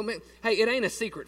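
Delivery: ordinary talk, nothing marked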